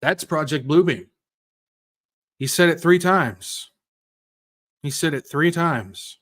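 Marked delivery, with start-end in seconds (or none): none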